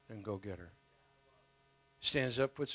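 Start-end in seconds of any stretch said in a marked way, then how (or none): 0.1-0.7 quiet, gentle instruction; soft command. Acted. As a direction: say it softly but firmly.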